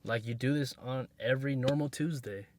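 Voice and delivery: ordinary talk, nothing marked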